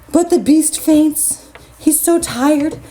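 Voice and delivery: ordinary delivery, nothing marked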